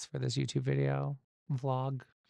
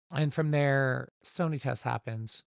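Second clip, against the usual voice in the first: ordinary talk, nothing marked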